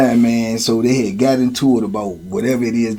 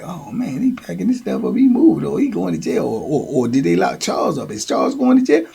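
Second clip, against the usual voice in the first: hushed gossip; 3 semitones higher, 5 dB quieter than usual. Retold or imitated. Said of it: "they looking, they like".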